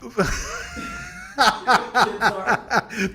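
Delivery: high, shouted burst of laughter. Real, not acted. Cracks up at she says bored.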